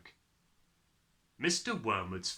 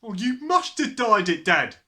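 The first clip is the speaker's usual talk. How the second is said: put-on raised, loud boy's retort; loud accusing; 11 semitones higher, 11 dB louder than usual. A narrator's reading. Son telling Dad what happened, loudly accusing. Son joins the family row.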